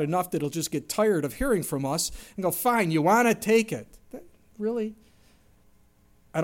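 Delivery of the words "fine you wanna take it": louder, fed-up concession; exasperated giving in. Pretend. God worn down by pestering, exasperated concession. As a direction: throw up your hands and give in.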